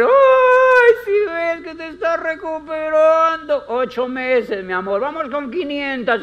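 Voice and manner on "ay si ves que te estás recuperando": much higher, louder, slow gushing praise; a shrieking, delighted cheer for the patient; excited cheering. Pretend. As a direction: gush over every tiny step.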